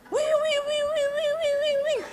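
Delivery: high wailing siren noise, sung out; siren imitation. Preacher imitating the hotel alarm going off, high sung wail. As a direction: wail it like an air-raid alarm. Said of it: "alarm bunyi".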